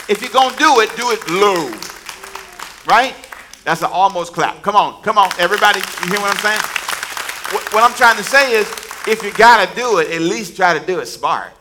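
loud, urgent call to the crowd; rally them, full force; rousing preaching. Real, not acted. Preacher urging congregation, loud and urgent.

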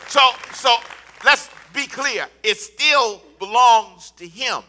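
loud, rising sermon declarations; full-force preaching. Acted. Pastor rousing the congregation, loud preaching. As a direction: drive it home to the crowd.

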